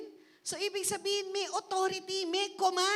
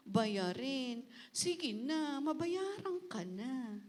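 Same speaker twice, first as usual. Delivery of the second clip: soft, slow, half-hearted pleading; timid pleading; 6 dB quieter, slower than usual. Shown as a demonstration. Preacher shows the wrong way to address problems, timid and soft. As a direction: beg the mountain meekly, almost apologising. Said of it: "Hindi mo sila sabing".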